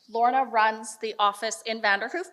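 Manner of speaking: ordinary delivery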